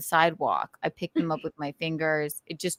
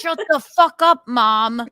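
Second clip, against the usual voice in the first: loud mock snap; 4 semitones higher, 7 dB louder, slower than usual. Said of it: "retold hush at Mom".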